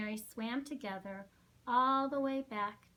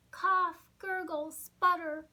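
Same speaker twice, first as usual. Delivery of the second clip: choking; 6 semitones higher, 7 dB louder than usual. Acted. Pip choking in water.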